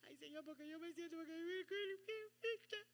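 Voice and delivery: quick, whimpering plea to the Lord, rising high at the end; plaintive prayer. Pretend. Believer sadly telling God how they feel, whimpering plea. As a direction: pour out a sad heart to God. Acting out a sad believer praying.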